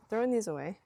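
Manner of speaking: usual speaking voice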